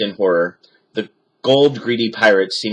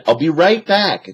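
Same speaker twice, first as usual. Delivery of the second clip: Jack calling; 7 semitones higher than usual. A narrator's reading. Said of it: "Jack called".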